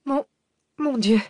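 high, stammered gasp of shock; half-stifled cry. A narrator's reading. Cécile looks into the open tomb, half-stifled cry. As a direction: cry out but try to hold it back. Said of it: "ne put qu'à demi réfréner un cri".

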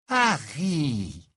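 long, falling throaty call; throaty, lingering greeting. A narrator's reading. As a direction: draw the name out from the throat. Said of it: "d'une voix de gorge".

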